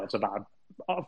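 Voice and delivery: usual speaking voice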